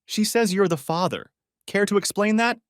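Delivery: high, furious demand. A narrator's reading. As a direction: challenge him in a rage. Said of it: "his voice shaking with fury".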